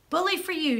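high, hearty cheer; shouted. A narrator's reading. Missus Stanton cheers the girl over the fence, hearty shout. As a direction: cheer her on from behind. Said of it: "shouted Missus Stanton".

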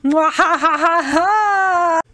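slow, loud evil villain gloat ending in a cackle; villain impression. Streamer's villain impression for chat, slow and loud with an evil cackle. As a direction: drag it out like a cartoon baddie. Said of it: "pretty good impression".

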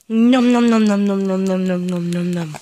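playful loud chomping noises; nom nom chomping. Pretend. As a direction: gobble the toes up, fading out. Parent chomping on baby's toes.

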